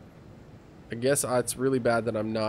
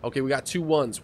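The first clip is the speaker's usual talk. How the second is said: ordinary delivery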